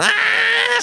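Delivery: very high, loud frustrated scream; little thug shrieks. A narrator's reading. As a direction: shriek out in despair. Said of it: "schreit der Kleine".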